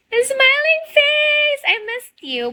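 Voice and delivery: reading a viewer's name aloud with delight. Real, not acted. Gasp then delight at a viewer.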